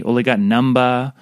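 normal talking voice

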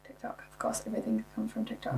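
hushed, voiceless aside; whispered aside. Real, not acted. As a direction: whisper it as the obvious answer. Knowing aside on slang's origin.

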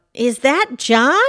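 slow questioning call, rising. A narrator's reading. Pam senses someone on the ice, calling out. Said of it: "she called out".

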